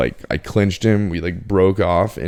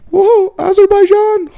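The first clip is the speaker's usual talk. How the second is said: very high, louder falsetto imitation of a wasted roommate; drunk roommate's catchphrase; 8 dB louder than usual. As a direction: squeal it like a giddy, wasted friend. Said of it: "he had some catchphrases".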